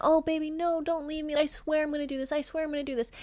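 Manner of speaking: mock pleading ex. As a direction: imitate a man begging not to be left. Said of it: "Would cry and plead".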